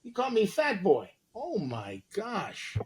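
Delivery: high, hammed-up shocked calling out; mock outrage. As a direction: play the offended victim calling for help.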